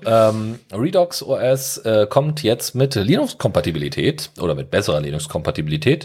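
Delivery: ordinary talk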